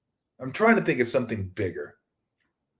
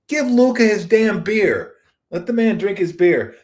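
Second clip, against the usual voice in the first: emphatic demand; 9 semitones higher, 5 dB louder than usual. Real, not acted. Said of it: "emphatic demand, odd voice".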